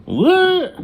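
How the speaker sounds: shrieked high and loud; shocked what. Retold or imitated. Boy told uncle will live with them, shrieking in shock. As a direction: blurt it out in total disbelief.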